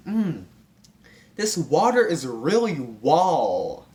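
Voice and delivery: trying out invented slang, drawn out and slow; mock slang example. Showing how any word becomes slang, mock-savouring. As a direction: savour each word slowly in mock approval. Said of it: "can I just go".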